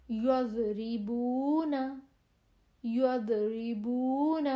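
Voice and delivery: یضربون with a heavy, pressed ض, very slow; firm daad. Shown as a demonstration. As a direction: hold and thicken the ض. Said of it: "جما کے اس کو نکالنا ہے".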